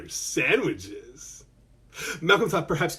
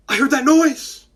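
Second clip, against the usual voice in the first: startled; 9 semitones higher, 5 dB louder than usual. Acted. Cooper startled by a thud.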